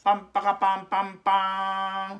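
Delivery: high, loud sung trumpet fanfare; mouth fanfare. Acted. Announcing the athletes' entrance with a loud high vocal fanfare. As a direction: trumpet it out with your voice. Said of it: "ファンファーレが鳴りました".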